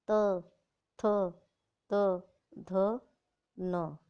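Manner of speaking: क, ख, ग… each said slowly and separately, with a clear अ sound; reciting consonants. Shown as a demonstration. Teacher recites consonants for children to hear the अ, slow and separate. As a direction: recite the व्यंजन one by one, pausing between each. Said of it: "अब इसको ध्यान से देखिए".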